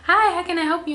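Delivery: high bright customer-service greeting; mock employee greeting; cheery clerk voice. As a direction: chirp it like a ticket clerk.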